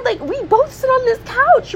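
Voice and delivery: rising disbelief about the shared couch; mock outrage. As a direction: pitch it up like you can't believe it. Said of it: "I'd be like".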